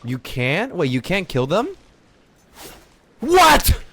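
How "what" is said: high, loud disbelieving what; real disbelief bursting out; shocked what. Real, not acted. Shocked he can't kill them.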